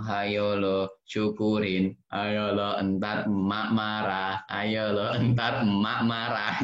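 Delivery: slow sing-song gloating; mocking taunt. Child gloating at sibling who got a bad grade, slow mocking. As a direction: tease slowly, savoring it. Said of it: "sebagian kita bilang".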